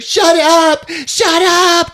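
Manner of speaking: very high mock scream; frantic, crazed. Duck at the screaming guy on the ground, imitated frantic shriek. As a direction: shriek it like someone driven crazy. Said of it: "it's, like, driving her crazy".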